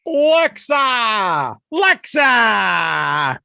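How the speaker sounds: put-on loud Boston accent call. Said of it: "I'm gonna talk with my Boston accent here".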